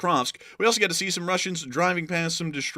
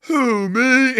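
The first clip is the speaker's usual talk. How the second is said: high, loud mock innocent; hammed-up who me; innocent shark voice; 10 semitones higher, 9 dB louder than usual. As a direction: play it wide-eyed innocent. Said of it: "that thing said".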